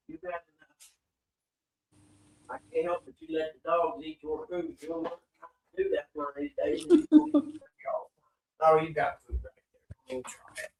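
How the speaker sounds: distant, quiet, off-mic; talking to the dogs elsewhere; from another room. Real, not acted. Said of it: "I'm in the other room real quick".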